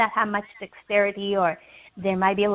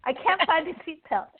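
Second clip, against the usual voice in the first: higher voice with an acted giggle; laughing; 6 semitones higher than usual. Cheerful passenger can't find the seatbelt, acted laughing. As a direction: giggle helplessly at your own fumbling. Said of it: "It comes in and they're laughing".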